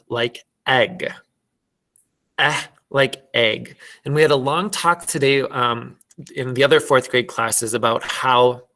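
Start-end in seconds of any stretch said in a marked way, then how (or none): 0.6-3.8 "eh" held apart, then "egg" with that vowel; short e sound. Shown as a demonstration. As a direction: EGG with an open short e, as in "bed". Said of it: "it should sound more like egg".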